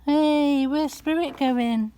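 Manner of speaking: high, loud, slow call after the cat. Real, not acted. Calling after her cat.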